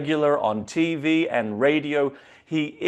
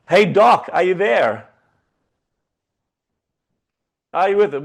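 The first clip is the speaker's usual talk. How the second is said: calling out; 4 semitones higher, 6 dB louder than usual. Real, not acted. Calling out to the remote guest.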